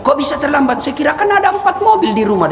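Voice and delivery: high, needling question; teasing Inem; mocking maid. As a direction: rub it in. Inem mocks rich master.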